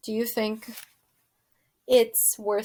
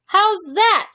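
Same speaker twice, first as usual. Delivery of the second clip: loud, higher shouted question; 9 semitones higher, 6 dB louder than usual. Acted. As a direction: shout it as if half-deaf. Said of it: "shouted Meg".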